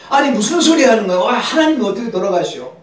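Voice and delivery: high, loud, rushed objection; acted indignant protest. Luther protesting to his wife, high loud indignant retort. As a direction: object in disbelief, loud and fast.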